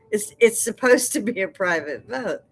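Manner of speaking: usual speaking voice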